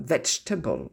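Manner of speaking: "vegetable" squeezed to "VEJ-tuh-bl"; silent second E. Shown as a demonstration. Teacher correcting, "vegetable" with silent second E. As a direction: skip the middle E in "vegetable". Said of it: "Pareil pour le deuxième E".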